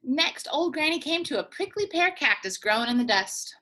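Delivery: plain reading voice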